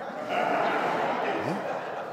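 quiet unvoiced chuckle; a low airy laugh to yourself; soft breathy laugh. Real, not acted. Preacher amused that the guy has his number, quiet laugh.

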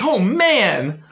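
high, loud, hammed-up thrill; mock-ecstatic exclamation; so excited. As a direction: burst out like an overjoyed kid. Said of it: "he's, like, so excited about it".

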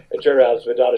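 ordinary talk, nothing marked

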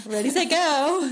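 high, loud ready set go; race start call. Pretend.

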